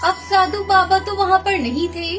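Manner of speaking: plain reading voice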